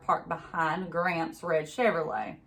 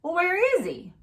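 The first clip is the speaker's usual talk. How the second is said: much higher, louder demand; sheriff questioning; 12 semitones higher, 4 dB louder than usual. A narrator's reading. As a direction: push hard for the grandfather.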